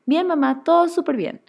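mucho más agudo y fuerte, falsamente contento; alegría fingida. Retold or imitated. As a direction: finge que todo va perfecto, agudo y alegre. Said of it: "y mentía". (hija mintiéndole a mamá, alegría exagerada y fingida)

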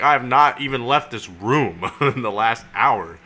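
slow, high, loud protest; emphatic retold denial. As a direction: hammer out each word as if wrongly accused. Retold denial of taking the boat.

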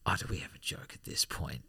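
whispered, teasing echo of the question; mock whisper. Co-host whispers the question back, teasing. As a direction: breathe it back conspiratorially. Echoing the joke question mockingly.

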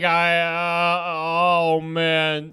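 slow, stretched-out dazed mumbling imitation; mock groggy daze; still-dazed groan. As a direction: drag it out as if still wasted. Imitating kid still high next day.

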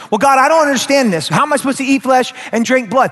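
high, rushed protest to God; baffled believer. Acted. Confused believer questioning God, baffled and rushed.

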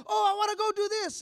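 put-on high-pitched excited kid; imitating eager son. Son asking father to let him go, high and eager. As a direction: pipe up eagerly like a boy.

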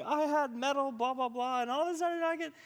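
high, loud, overexcited imitation; bigger freak-out. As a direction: squeal the testimony through in a rush of amazement. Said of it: "it's, like, a little bit bigger".

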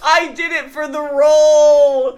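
anguished wail. Acted. Guest defending method acting, loud wail. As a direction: wail it out desperately. Wailing explanation.

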